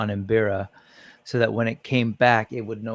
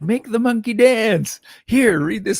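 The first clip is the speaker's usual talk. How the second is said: high sneering mock crowd, louder; mocking demand; 10 semitones higher, 5 dB louder than usual. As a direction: parody the crowd demanding a show. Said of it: "It's like".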